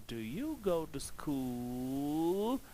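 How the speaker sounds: "Do you go to school?" slowly, dipping then rising on "school"; rising question tone. Shown as a demonstration. Teacher shows students a rising question, slow and lifted. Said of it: "The tone is rising".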